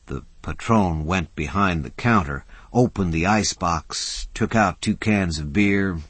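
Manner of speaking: plain reading voice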